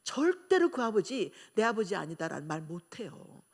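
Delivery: ordinary talk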